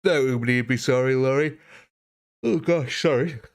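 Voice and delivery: words stretched through a yawn. Real, not acted. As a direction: talk mid-yawn. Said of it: "Big yawn there".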